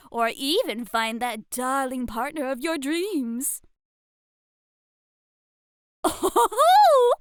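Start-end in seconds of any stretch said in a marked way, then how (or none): 6.0-7.1 high playful gasp; a delighted, knowing oh; teasing surprised oh. Acted. Teasing about a blush.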